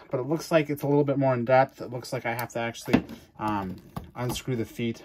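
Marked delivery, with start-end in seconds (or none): none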